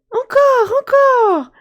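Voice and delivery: put-on high, loud delighted boy; excited child. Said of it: "Jack est tout excité".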